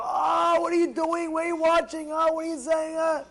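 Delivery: mock-furious, high-pitched yelling; angry outburst. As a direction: blow up in a hammed-up angry shout. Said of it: "so why are you getting angry?".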